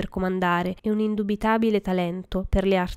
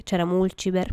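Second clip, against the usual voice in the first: usual speaking voice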